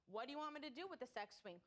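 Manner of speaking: ordinary talk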